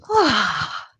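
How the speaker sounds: big falling "ah" sigh; open sigh. Real, not acted. Yoga teacher rising from a forward bend, sighing out. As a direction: release the breath in a voiced sigh. Sighs after rising back up.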